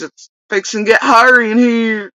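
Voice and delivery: exaggerated hillbilly imitation; doofus accent. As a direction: demonstrate the doofus Kentucky accent. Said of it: "in that doofus accent".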